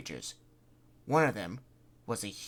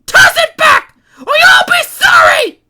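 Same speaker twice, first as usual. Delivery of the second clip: frantic threat; 24 dB louder, slower than usual. Acted.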